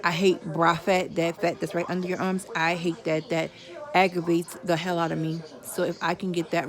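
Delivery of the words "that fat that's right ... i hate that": ordinary talk, nothing marked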